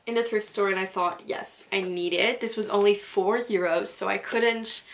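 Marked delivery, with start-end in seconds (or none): none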